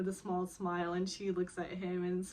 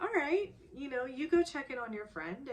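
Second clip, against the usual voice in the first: bright agreeing; 6 semitones higher than usual. Retold or imitated. Voicing him agreeing.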